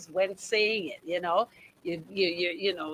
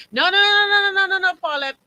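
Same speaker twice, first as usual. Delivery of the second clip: loud, high run of no's; real emphatic refusal; loud protest; 11 semitones higher, 7 dB louder, faster than usual. Real, not acted. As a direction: stop her right there, laughing protest. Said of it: "I can't even read that that way".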